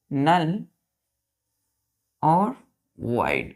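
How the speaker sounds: slow, drawn-out dictation for writing. Shown as a demonstration. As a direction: dictate each word slowly for the class. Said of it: "teacher dictating slowly". (धीमे-धीमे बोलकर लिखवाना)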